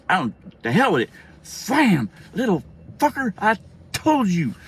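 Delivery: high, loud, punched-out phrases; retold furious mother, drawing out the last words; angry mom scolding. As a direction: punctuate each burst like a swat. Enacts mother swatting while scolding.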